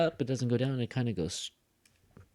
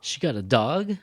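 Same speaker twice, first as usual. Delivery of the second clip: louder echo with "dog" swinging up; surprised rising question; 5 dB louder than usual. Shown as a demonstration. Teacher repeats the example for learners, surprised rise. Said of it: "that's a tag question".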